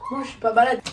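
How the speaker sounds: loud protest. Acted. Girl protests loudly.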